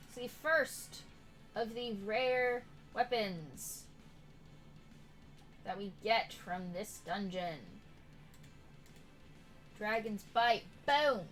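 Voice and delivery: ordinary talk, nothing marked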